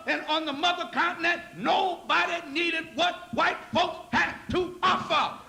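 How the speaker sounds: a lecturer rising into a sermon shout; fiery preaching. Acted. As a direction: preach it at full force to the congregation.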